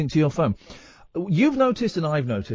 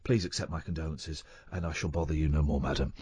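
very posh, soft English apology; lightly voiced, bumbling posh Englishman; Hugh Grant impression; 11 semitones lower, 8 dB quieter than usual. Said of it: "I did my very best Hugh Grant verbally".

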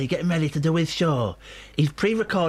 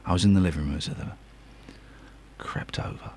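hushed, creeping storyteller whisper; suspenseful whisper; 10 semitones lower, 4 dB quieter than usual. Acted. Host whispering the cat chase for suspense. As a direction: build suspense in a near-silent whisper. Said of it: "Exciting, this".

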